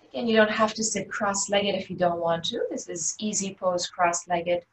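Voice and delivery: ordinary talk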